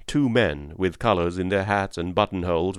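ordinary delivery, nothing marked

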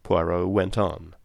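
ordinary delivery, nothing marked

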